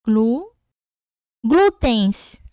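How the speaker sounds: teacher splitting glútens into parts; syllable-by-syllable demo. Shown as a demonstration. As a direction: break Glu- off, then say glútens whole. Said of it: "Então, fica assim, ó".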